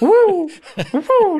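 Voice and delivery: high loud laughing woo; slipping screws' yelp. Retold or imitated. Officer mimics guards slipping on porridge, high laughing whoop. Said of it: "like this".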